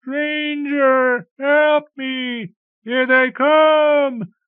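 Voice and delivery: very high, loud and stretched-out plea; shrill desperate cry for help; shrill ghost cry. A narrator's reading. A ghost shrilling for help at the stranger. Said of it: "the voice shrilled".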